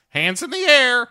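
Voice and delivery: high, loud mock parrot squawk. Parrot calls out from his cage, high and loud. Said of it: "Wilson the parrot".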